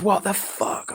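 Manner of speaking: losing it. Retold or imitated. Brother losing it with the doctor, high-pitched retold anger. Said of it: "I lost it with the doctor".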